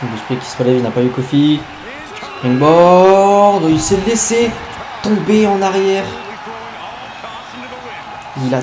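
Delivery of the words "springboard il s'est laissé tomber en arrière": high excited play-by-play; excited call. Real, not acted. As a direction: call the dive with rising hype. Calling a big dive live.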